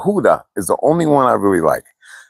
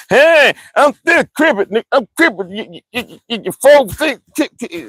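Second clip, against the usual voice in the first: stuttering high caricature of a friend missing fingers; mocking the injured guy; 13 semitones higher than usual. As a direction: ham up a high stammering cripple impression.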